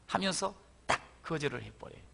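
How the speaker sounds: usual speaking voice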